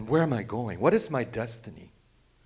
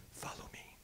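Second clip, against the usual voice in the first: nearly silent, much softer hush; breathy whisper; 21 dB quieter than usual. Real, not acted. Preacher voicing Jesus calling followers, whispered. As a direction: barely breathe the call.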